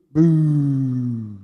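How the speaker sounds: loud drawn-out boo; loud, long pantomime boo; mock boo. Leading crowd booing.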